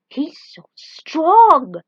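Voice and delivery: slow, loud drawn-out amazement; awed gasp. Acted. Genie watching the electric dragon, slow and awestruck. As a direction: marvel at the raw power.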